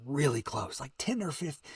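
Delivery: ordinary delivery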